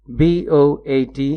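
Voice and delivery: letter-by-letter dictation pace; slow spelling. Shown as a demonstration. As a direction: slowly spell out बीओएटी letter by letter. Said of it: "जब syllable में एक नहीं दो vowels हों".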